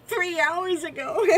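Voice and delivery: high, loud laughing delivery; real laughter. Real, not acted. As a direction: laugh through the words. Laughing while explaining.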